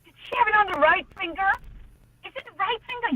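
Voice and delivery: high-pitched retold question; mock wondering. Retelling how everyone speculated about her ring, mock wondering.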